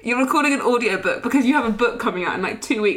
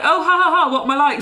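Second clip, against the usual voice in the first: higher, louder put-on jokey laugh; chummy banter; 5 semitones higher, 4 dB louder, faster than usual. Talking it away to a stranger like a best friend, chummy. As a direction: act overly pally to laugh off a blunder. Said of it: "as if she was, like, one of my best friends".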